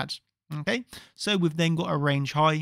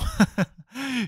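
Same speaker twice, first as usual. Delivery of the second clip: laugh; 8 semitones higher than usual. Real, not acted. Laughs after crediting Igor.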